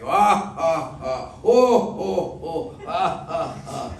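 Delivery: big joyful belly laugh, rising high; loud, high delighted guffaws; hearty laughing. Acted. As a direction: laugh loud and free like a blissful giant. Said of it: "rolling in the dust and laughing".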